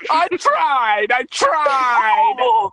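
high, loud fake sobbing cry; mock wail. Mock despair, repeated.

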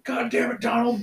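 high-pitched mock exasperation; Peyton Manning. Friend imitating quarterback scolding a lineman, high mock exasperation. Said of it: "one of the linemen fuck up, and he's like".

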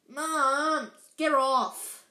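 loud, high protesting yell; annoyed yell. Acted. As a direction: shove her off, fed up. Ron wriggling from mother.

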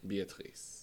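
"Beatrice" said the French way, bay-ah-TREECE; soft French-accented "Beatrice" ending in -treece; French pronunciation. Shown as a demonstration. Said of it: "'Cause it's a French name".

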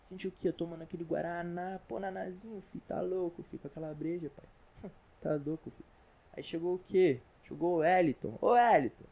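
lightly put-on Rio slang voice, casual and lively; carioca beach guy. Beach guys greeting Wellington about Flamengo, carioca banter. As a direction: play a carioca lad chatting at the beach. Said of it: "Com a entonação do lugar original".

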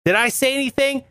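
ordinary talk, nothing marked

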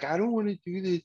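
mock whining. Imitating someone unhappy about a situation, mock whine. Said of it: "trying to let everybody know how unhappy".

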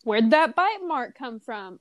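ordinary delivery

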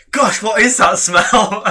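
feigned innocence. Retold or imitated. Telling friends how they reacted to the fart, mock wonder. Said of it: "I was sitting there going".

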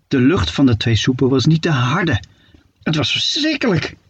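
ordinary delivery

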